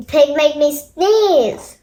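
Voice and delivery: ordinary delivery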